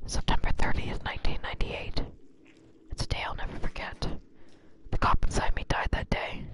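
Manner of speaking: forceful stage whisper into the mic; Leon whispering. Acted. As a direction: whisper it like a dramatic confession. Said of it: "that's how Leon whispers to me".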